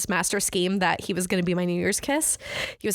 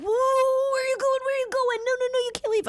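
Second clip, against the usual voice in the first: high-pitched, hammed-up protest; mock begging. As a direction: imitate him begging the group to stay. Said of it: "like, begging".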